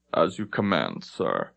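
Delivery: low, clipped and quiet; stiff submission. Acted. Lieutenant accepting dismissal from the captain, low and stiff. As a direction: swallow the insult and obey.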